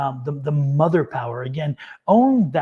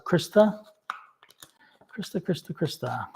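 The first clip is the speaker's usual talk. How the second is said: soft calling; 5 dB quieter, slower than usual. Real, not acted. Calling next viewer softly.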